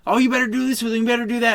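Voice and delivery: high, loud bossy imitation; the other driver heckling the cop, imitated high and loud; mock yelling. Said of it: "the other guy is sitting there yelling".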